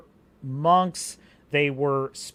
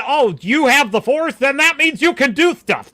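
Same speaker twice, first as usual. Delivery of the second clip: loud, high mock awe; mocking excitement; 8 semitones higher, 8 dB louder than usual. Mocking writers who make Jedi superheroes, loud and high. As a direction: crow it in an over-the-top dumb voice.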